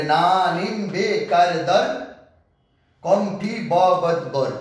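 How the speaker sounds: slow, spaced-out chant of the prefixes; a teacher slowly reciting a list of prefixes for students; slow recitation. Shown as a demonstration. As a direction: say each prefix slowly and separately, as a class drill. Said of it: "teacher recites prefix list slowly".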